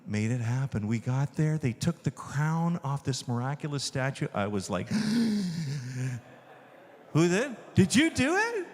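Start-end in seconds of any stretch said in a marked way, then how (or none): 4.9-6.2 sharp intake of breath, acting shocked; re-enacted gasp. Reacting to crown being removed. 7.1-8.6 high-pitched, startled questioning; acted astonishment. Retold startled question after gasp.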